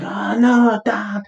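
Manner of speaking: high, loud mock wail; child's distressed cry. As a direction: cry out high like a horrified kid. Kids find their shot dad.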